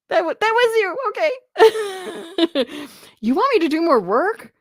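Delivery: high, squealing laughter through the words; laughing. Real, not acted. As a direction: crack up in disbelief. Surprised by new subscriber.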